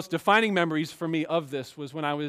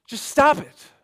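sharp high mock scold, tossed off; dismissive "just stop it"; 9 semitones higher, 7 dB louder than usual. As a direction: brush the sinner off in one sharp line. Mocking harsh advice quoted.

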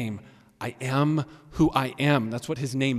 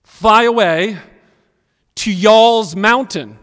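loud, higher, deliberate word-for-word read; wooden literal reading; 4 semitones higher, 10 dB louder, slower than usual. Shown as a demonstration. Preacher reads a literal translation to the congregation, woodenly. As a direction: read it out loud and stiffly, phrase by phrase. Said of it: "woodenly as possible from Hebrew to English".

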